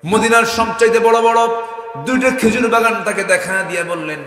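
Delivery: high, loud storytelling cry; full-force preaching. Real, not acted. Preacher telling of the two date gardens, loud impassioned preaching. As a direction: trumpet the story out to the crowd.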